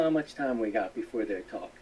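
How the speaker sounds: normal talking voice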